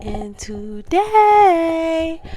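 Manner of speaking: slow, stretched and high; drawn out. Real, not acted. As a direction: stretch out the words. Drawn out vlog intro.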